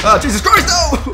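high, loud cry of alarm; startled shout. Real, not acted. Player gets ambushed, high loud startled shout. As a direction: shout out in sudden panic. Player suddenly attacked in game.

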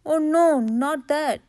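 dismayed protest; alarmed. A narrator's reading. Rabbit hears that honey is on offer, alarmed.

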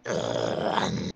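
slow, soft, rasping creature voice; creature whisper. Pretend. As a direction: hiss it like an eerie beast. Voicing game creatures.

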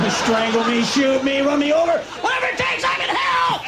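rising from pleading to a high scream; begging friends, building to a high scream. Acted. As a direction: climb into a desperate shriek. Begging to be killed, escalating.

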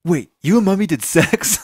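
put-on high, loud little kid asking; child voice. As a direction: play the youngest child, shocked and innocent. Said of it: "And my youngest goes".

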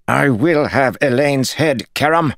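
high, loud obsessed demand; raging lord. A narrator's reading. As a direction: spit out her name. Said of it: "Jared said".

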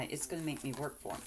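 quick whispered aside. Real, not acted. Repeating her joke about the paper clips to herself, a quick whisper. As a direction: whisper it to yourself, hurried.

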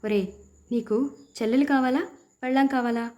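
ordinary delivery, nothing marked

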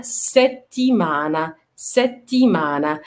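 slow "ستيمانا" with a held t; doubled t. Shown as a demonstration. Teacher modelling repeated letters slowly, doubled t. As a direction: break "ستيمانا" at the long double t. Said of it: "لازم ننطقها مرتين".

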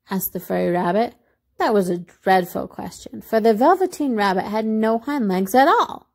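ordinary delivery, nothing marked

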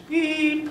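high, sharp beep sound; imitated beep. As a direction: chirp it like a phone machine beep. Imitating the answering machine beep.

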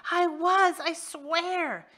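high, fast, frantic excuse; desperate pleading. Acted. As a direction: gabble to save your skin.

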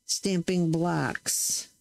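very slow "stamping blocks", each word pressed; emphasizing blocks. Real, not acted. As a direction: say "stamping blocks" slowly with weight on "blocks". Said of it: "emphasizing the word blocks".

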